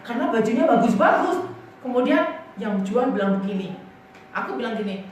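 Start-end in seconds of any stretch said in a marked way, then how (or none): none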